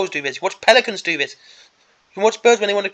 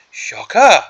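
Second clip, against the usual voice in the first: mock surprise, dripping with sarcasm; bored sarcastic gasp; sarcastic shocker; 4 semitones higher, 5 dB louder than usual. Real, not acted. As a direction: fake astonishment to mock the cliché. Sarcastic reaction to stereotype model.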